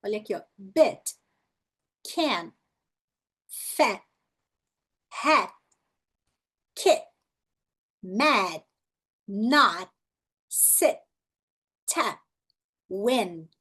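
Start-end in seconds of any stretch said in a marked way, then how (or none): 0.6-1.2 brief vowel in each one-syllable word; short vowel. Shown as a demonstration. Teacher modelling word list, short vowels. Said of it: "a pronúncia da vogal é curta". 2.0-13.5 brief vowel in each one-syllable word; short vowel. Shown as a demonstration. Teacher modelling word list, short vowels. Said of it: "a pronúncia da vogal é curta".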